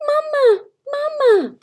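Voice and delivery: high, breathy little whispered peep; a tiny hatchling whimpering for mama; hushed, high baby-bird cry; whispered gosling peep. A narrator's reading. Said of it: "peeped the gosling".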